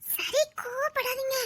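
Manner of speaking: very soft, very slow wheedle; coaxing. Acted. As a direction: draw each word out sweetly and softly. Coaxing him once more. (மிக மென்மையான கொஞ்சல்)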